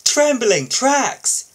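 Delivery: high, loud, slow startled cry; a loud drawn-out gasp of surprise; startled exclamation. Acted. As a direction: jump at the sudden noise. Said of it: "said Kevin as a train rushed by".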